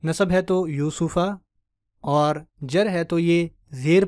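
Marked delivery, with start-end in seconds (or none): 0.0-1.2 यूसुफा said slowly, ending on a clear "फा"; slow teaching demo. Shown as a demonstration. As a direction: spell it out slowly for students. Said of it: "रफ़ा में तो पेश की हालत से बताते". (धीमे, यूसुफा पर ज़ोर)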